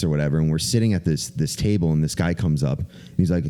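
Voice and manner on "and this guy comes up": normal talking voice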